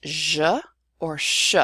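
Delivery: "je" as zhuh, then "sh" as shuh; Argentine variants. Shown as a demonstration. Teacher showing Argentine sounds, "je" as zhuh, "sh" as shuh. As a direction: buzz "je" like measure, then hush "sh". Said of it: "it's sometimes pronounced".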